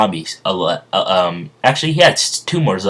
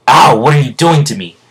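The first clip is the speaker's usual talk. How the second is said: high, loud yelp of complaint; Dio's pained protest; 6 semitones higher, 6 dB louder than usual. Acted. Dio to Jay sucking his blood, loud pained protest. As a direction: act out crying out at a bite. Bitten character cries out.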